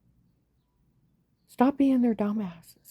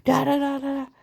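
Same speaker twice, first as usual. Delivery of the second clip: mock scream; 7 semitones higher, 4 dB louder than usual. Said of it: "I'm gonna start screaming".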